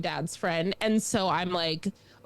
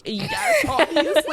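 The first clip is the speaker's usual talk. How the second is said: high, louder, laughing retort; mock indignant; 5 dB louder, slower than usual. Lost child answering her rescuer, high mock indignation. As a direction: act out an exasperated little kid.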